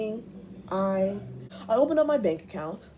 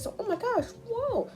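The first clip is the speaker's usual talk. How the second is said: high surprised squeal. Retold or imitated. On hearing her name is Ice, a high amazed whoa.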